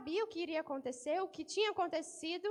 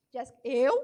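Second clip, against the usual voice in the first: mock surprise; 5 semitones lower, 9 dB louder than usual. Peter denying, acted.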